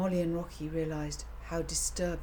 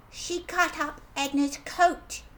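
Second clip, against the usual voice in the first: put-on child voice; 10 semitones higher, 5 dB louder than usual. A narrator's reading. Child voice, excited.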